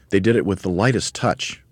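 plain reading voice

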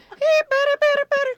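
imitating a very high, piercing batter chant; comic falsetto chatter; high-pitched heckle. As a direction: mimic her squeaky ballpark call way up high. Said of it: "she has a real high-pitched voice".

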